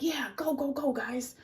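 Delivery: high, eager cheer; a clam cheering. Acted. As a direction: cheer them on. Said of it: "he's like".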